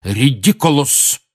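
firm, slow, clear spell. Acted. Lupin banishing the boggart with a firm clear voice. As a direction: say it steady and decisive. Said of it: "очень твёрдым и ясным голосом".

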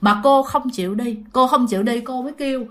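ordinary delivery

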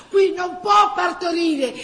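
loud, high, slow order; firm shout. Acted. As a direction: insist she be moved upstairs. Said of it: "dice una donna".

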